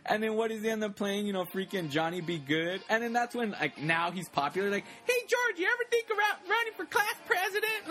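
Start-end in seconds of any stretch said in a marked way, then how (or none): 5.1-7.9 very high-pitched mock classmate; fawning classmate. Classmate to suddenly popular George, high mocking squeal.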